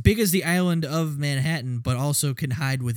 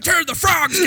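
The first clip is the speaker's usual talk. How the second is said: high, loud mock rant; hammed-up conspiracy-nut shriek; Alex Jones scream; 5 dB louder than usual. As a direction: scream it like a panicked crank. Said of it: "start screaming like Alex Jones".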